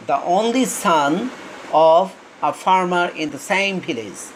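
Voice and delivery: each word stretched out very slowly; slow reading aloud. Shown as a demonstration. Teacher rereads the sentence for students, very slowly. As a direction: read it out word by word. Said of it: "slow dictation reading".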